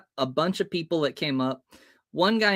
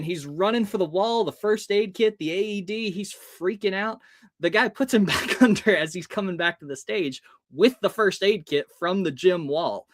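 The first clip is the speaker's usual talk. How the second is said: ordinary delivery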